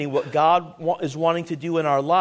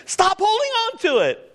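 yelling; 14 semitones higher than usual. Acted. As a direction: cry it out hard at the flock. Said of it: "I'm yelling at me".

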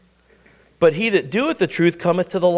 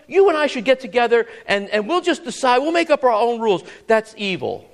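usual speaking voice

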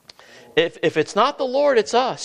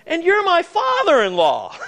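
David's incredulous thought; 10 semitones higher than usual. Acted. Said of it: "the thought may have crossed his mind".

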